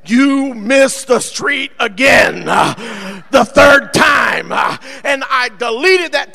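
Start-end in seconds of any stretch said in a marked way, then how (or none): 0.0-4.5 exasperated shout, every word stretched out; all-caps shout. Retold or imitated. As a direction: yell it slowly like an all-caps angry text. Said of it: "I started capitalizing one of my texts".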